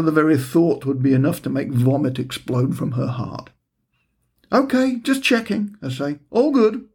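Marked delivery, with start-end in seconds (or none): 4.5-5.8 high, quick retreat from a bad idea; husband backpedalling to his wife, high and sheepish; sheepish backpedal. Backpedalling to wife's look. 6.3-6.9 high, quick retreat from a bad idea; husband backpedalling to his wife, high and sheepish; sheepish backpedal. Backpedalling to wife's look.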